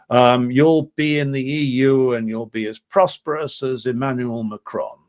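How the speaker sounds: patronising posh British reassurance, dripping with condescension; posh British accent. Retold or imitated. Western diplomat placating Nikol Pashinyan, in a mocking posh British accent. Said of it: "I'll do a posh British accent for this one".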